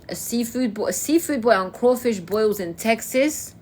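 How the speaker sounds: normal talking voice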